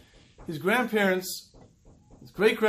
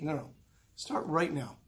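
firm quiet urging; 4 semitones lower, 8 dB quieter than usual. Real, not acted. Rebutting the dieter.

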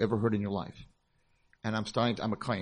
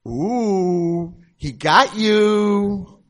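high, loud, drawn-out taunt; teasing kids; 9 semitones higher, 12 dB louder, much slower than usual. Retold or imitated. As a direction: tease like kids on the couch.

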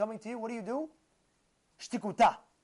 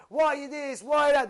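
high, loud mock protest; mock tough-guy complaining; 6 semitones higher, 7 dB louder than usual. Tough guy questioning Hashem, mock complaining. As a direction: imitate someone griping at heaven. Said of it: "Don't be a tough guy".

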